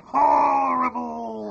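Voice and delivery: slow, loud pinched-nose drawl; nose-held voice. Shown as a demonstration. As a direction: hold your nose and stretch it out. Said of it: "hold your nose and say".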